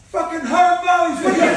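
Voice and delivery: high, loud jeering yell, slowed; imitating a loud heckler; mock heckler shout. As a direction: bellow it like a lout across the park. Imitating passerby heckling them.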